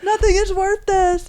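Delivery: high, slow lament; hammed-up dismay; mock despair. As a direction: act the appalled passenger, drawn out and high. Voices the dismayed passenger in the story.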